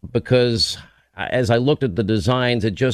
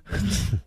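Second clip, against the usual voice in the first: laugh; 9 semitones higher, 6 dB quieter than usual. Real, not acted. Host teased about running over.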